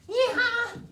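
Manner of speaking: very high, loud yeehaw whoop; whooping yell. Shown as a demonstration. Teacher playing a galloping donkey reacting to an accent, high loud whoop. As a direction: burst out like a braying, galloping donkey.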